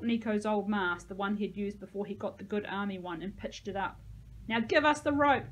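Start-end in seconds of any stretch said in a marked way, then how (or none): 4.5-5.5 loud, high demand; yelled. A narrator's reading. Narrator demands the promised rope, yelled. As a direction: yell it up as an order. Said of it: "I yelled".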